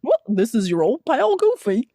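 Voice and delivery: Goofy voice with flexible cheeks; Goofy impression, roomy mouth. Shown as a demonstration. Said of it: "more effort into my cheeks".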